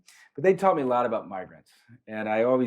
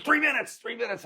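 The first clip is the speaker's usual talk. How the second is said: high, strained repeat of the count; mock the urgent cry of a woman in labour; 14 semitones higher than usual. Pregnant girl in the car warns him, urgent high cry.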